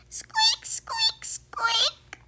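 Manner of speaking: very high breathy squeak; one sharp little squeak; tiny squeak. Acted. Baby creature answering Fox, squeaking.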